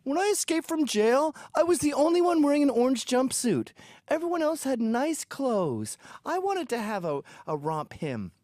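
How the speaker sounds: high, mocking whiny voice; a hint of a sniveling refugee; mock whiny immigrant. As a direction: lay on a sniveling tone. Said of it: "and they go".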